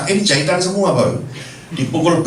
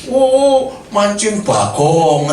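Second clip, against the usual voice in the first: long drawn-out jeer, opening higher; mocking angler; 4 semitones higher, much slower than usual. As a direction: imitate a heckling fisherman, dragging out the words. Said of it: "yang di sana mancing".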